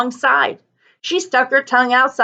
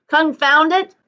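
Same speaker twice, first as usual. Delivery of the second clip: a mock yell of frustration, pitched up; yelled curse; 6 semitones higher than usual. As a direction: snap it out like a thwarted driver. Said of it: "he yelled".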